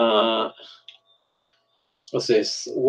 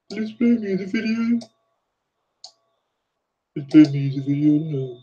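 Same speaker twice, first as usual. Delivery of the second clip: playful broad Birmingham accent, pitched up; 3 semitones higher than usual. Shown as a demonstration. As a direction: put on a thick Brummie accent. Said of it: "That's my Birmingham accent".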